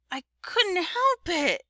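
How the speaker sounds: put-on high, slow little-boy whine; whiny child excuse; whining. A narrator's reading. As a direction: drag it out, whining. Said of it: "kind of whining".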